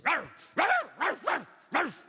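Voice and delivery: high, loud mock barking; imitated dog bark. Preacher acting out his puppy spotting a truck, imitated barking. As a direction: yap like an excited puppy at a truck. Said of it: "he starts barking at the truck".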